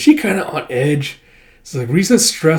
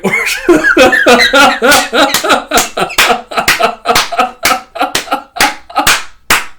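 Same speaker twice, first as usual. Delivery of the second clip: real high-pitched cackling fit; long high laugh; 11 semitones higher, 6 dB louder than usual. Real, not acted. Reader cracking up at her slang version, laughing. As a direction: crack up at her own joke.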